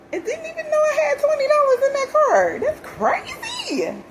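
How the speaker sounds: high, loud, laughing; high squealing laugh of disbelief. Real, not acted. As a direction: laugh it out, amazed at the luck. Laughing disbelief over found money.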